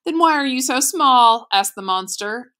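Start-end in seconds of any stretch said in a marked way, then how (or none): 0.1-1.5 higher, louder taunting question. A narrator's reading. Bully asking Bruno about his size, taunting. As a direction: needle him with a raised, loud voice.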